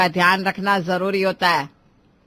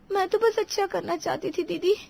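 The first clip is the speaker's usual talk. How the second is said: high, soft hurt voice; meek protest; 8 semitones higher, 6 dB quieter than usual. Acted. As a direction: explain herself timidly. Scolded bride pleads meekly. (दबी, आहत आवाज़ में सफ़ाई)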